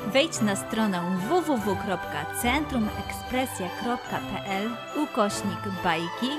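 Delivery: usual speaking voice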